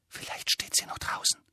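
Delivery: unvoiced whisper. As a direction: whisper it barely audible. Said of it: "hauchte sie ihm ins Ohr".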